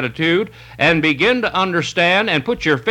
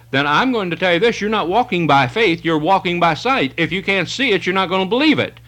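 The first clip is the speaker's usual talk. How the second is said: normal talking voice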